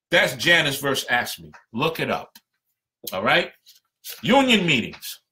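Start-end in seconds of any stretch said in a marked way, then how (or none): none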